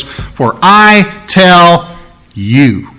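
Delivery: each word slowed and weighted; drawn-out, pointed delivery; emphatic. Shown as a demonstration. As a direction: lean hard on every word. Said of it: "He is specifically talking to the one".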